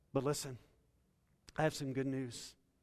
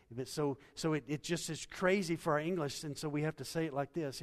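usual speaking voice

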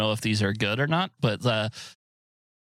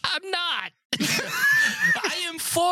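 laughing; much slower than usual. Real, not acted. Mock Nazi banter, laughing.

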